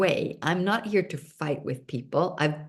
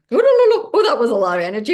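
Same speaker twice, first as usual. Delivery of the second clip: much higher and louder, rushed mock alarm; overwhelmed exclamation; 6 semitones higher, 9 dB louder, much faster than usual. Speaker reacts to the universe's intensity, loud high mock alarm. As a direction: yelp it out fast, swamped by too much. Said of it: "So sometimes it's like".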